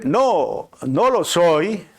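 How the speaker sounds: drawn-out, lightly acted denial; denying fugitive. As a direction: act out a man protesting mildly. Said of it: "Y si decía el hombre".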